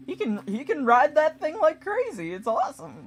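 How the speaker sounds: high, loud amazed praise; excited cheer. Retold or imitated. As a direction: gush like a thrilled onlooker. Vikings cheering at the horse.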